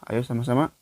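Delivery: ordinary talk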